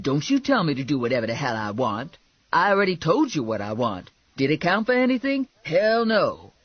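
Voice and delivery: fast, emphatic sassy comeback; indignant Lula. Acted. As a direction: argue back hard, building to a loud finish. Lula arguing back.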